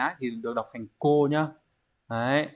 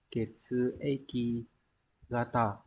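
slow reading model; 7 dB quieter, much slower than usual. Shown as a demonstration. Teacher models Japanese reading.

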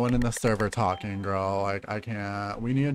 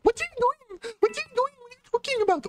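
extremely high squeal; squeaky, high playful alarm; shrieky mock panic. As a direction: squeal it way up in the top of the voice. Panicking at another player in game.

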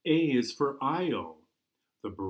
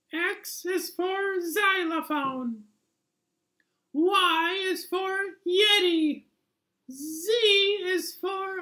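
very high, silly little puppet voice reading the alphabet slowly; a tiny cartoon critter sounding out letters; squeaky puppet voice; 5 dB louder, slower than usual. Puppet hosting its alphabet show, very high squeaky voice.

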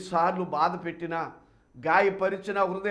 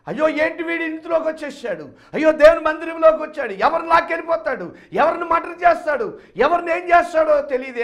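loud, higher alarmed gossip; fearful townsfolk; 11 semitones higher, 11 dB louder than usual. Acted. Townspeople fearing the son, loud alarmed cry. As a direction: voice the scared crowd, louder and higher. Frightened believers voiced.

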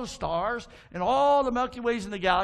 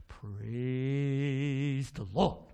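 very slow, stretched-out low bird call; talking bird; 7 semitones lower, much slower than usual. Acted. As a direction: draw it out long, starting low. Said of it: "give me a good one".

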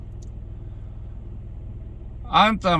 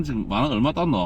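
ordinary delivery

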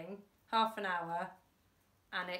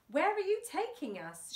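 much higher, alarmed call; shouted; 9 semitones higher, 4 dB louder than usual. A narrator's reading. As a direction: cry out over the bumping car. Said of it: "shouted Fingers".

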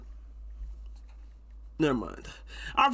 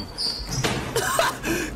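cartoon laugh; 13 semitones higher than usual. Real, not acted. Laughing at clip.

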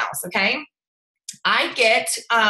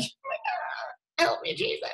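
a squeaky, much higher, softer cry for help; mock overwhelmed plea; 8 dB quieter, slower than usual. Coach to viewers overwhelmed by messages, squeaky mock plea for help. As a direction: play it like you're drowning in messages, half joking. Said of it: "just be like".

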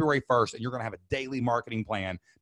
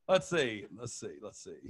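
fading mutter; 3 semitones lower, 8 dB quieter, slower than usual. Real, not acted. Scrolling through chat questions.

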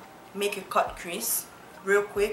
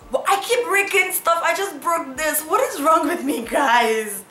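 exasperated; 7 semitones higher, 6 dB louder than usual. Real, not acted. Keeps breaking stuff.

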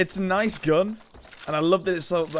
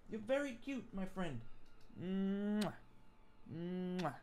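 cooing to pet; 3 semitones higher, 17 dB quieter, much slower than usual. Real, not acted. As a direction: coo it to a puppy. Cooing softly to his dog.

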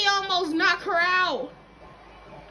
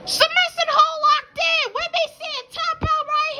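play-by-play; 10 semitones higher, 4 dB louder than usual. Pretend. Calls the action live.

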